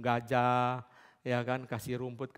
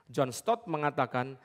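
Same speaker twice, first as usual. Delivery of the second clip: normal talking voice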